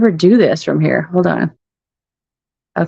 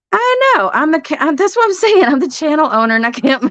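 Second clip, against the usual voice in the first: exasperated outburst; 7 semitones higher than usual. Real, not acted. Frustrated she can't comment.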